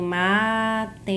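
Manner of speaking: "matemática" drawn out one syllable at a time; each syllable of "matemática" held separately. Shown as a demonstration. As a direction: split "matemática" slowly into ma-te-má-ti-ca. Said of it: "Essa parte de divisão silábica".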